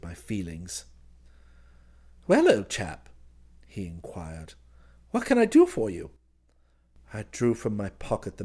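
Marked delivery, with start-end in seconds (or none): 2.3-3.1 put-on high, loud hail to an old pal; jovial old chum. Cousin greets the narrator jovially. 5.1-6.1 very high, loud, breezy question; cheery helpful chum. Acted. Cousin offers help cheerily.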